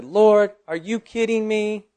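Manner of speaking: high-pitched, exasperated protest; indignant, incredulous complaint; retold anger. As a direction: protest to God in disbelief, stretching the words. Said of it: "I turned to the Lord in anger".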